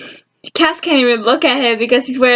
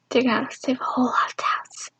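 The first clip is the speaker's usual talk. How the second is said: soft whisper, almost no voice; whispered aside; 10 dB quieter than usual. Real, not acted. Vlogger whispering a joke to viewers. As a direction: let it slip out as a secret.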